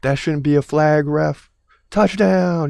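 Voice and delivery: shouting at the ref, rising; yelling at the tv. Retold or imitated. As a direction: yell at the screen.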